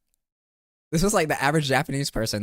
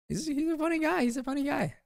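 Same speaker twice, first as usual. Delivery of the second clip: cutesy high voice. Pretend. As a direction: coo it like a cute mascot. Cutesy high voice about character.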